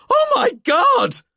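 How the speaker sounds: very high, loud, slow screech. A narrator's reading. Emer screeching at the sight of the skeleton. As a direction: shriek in terror. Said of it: "screeched Emer".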